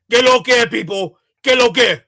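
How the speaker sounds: loud, mocking Spanish greeting; mock Dominican guy. Mocking the Dominican guy who says hi, loud. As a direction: call it out like the guy he's mocking. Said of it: "It's gonna say".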